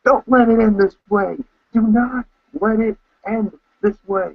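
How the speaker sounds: high desperate pleading yell; retold scream at the TV. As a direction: beg the TV not to let it end. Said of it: "I'm, like, screaming at the T V saying".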